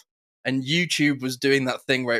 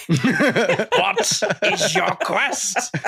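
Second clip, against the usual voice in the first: mock Monty Python bridgekeeper; 6 semitones higher, much slower than usual. Interviewer's inner voice facing celebrity, stagey bridgekeeper. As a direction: stretch it out like a knight's riddle. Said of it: "my brain is going".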